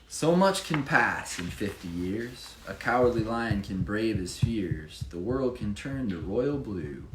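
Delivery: lively rhyming read-aloud; bouncy storybook reading voice; story narration. Acted. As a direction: read it like a picture book for children. Reads rhyming Chiefs picture book.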